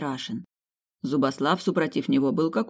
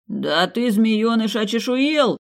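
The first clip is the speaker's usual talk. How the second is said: higher, louder shocked yell; loud shocked exclaim; astonished outburst; 7 semitones higher, 4 dB louder, slower than usual. Acted. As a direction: explode in disbelief at the grandson. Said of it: "удивился дед".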